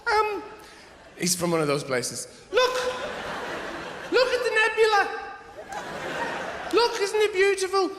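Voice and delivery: very high, dreamy breathless wonder; Brian Cox impression. TV physicist marvels at the nebula to viewers, high awed voice. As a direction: gush over the stars in a high awed voice. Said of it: "he would come on and go".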